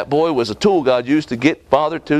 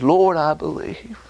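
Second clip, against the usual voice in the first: pleading father; 2 semitones higher than usual. Acted. Father pleads with Jesus, crying.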